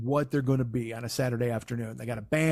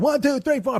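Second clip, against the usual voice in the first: high, loud band count-in; punk count-off; 12 semitones higher, 5 dB louder than usual. Retold or imitated. Describing the band's aggressive punk set, shouted count-off. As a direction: shout it like kicking off a punk song.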